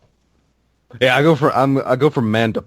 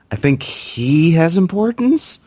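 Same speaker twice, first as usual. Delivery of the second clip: mock doctor; 10 semitones higher, slower than usual. S1 joins the acted ER scene.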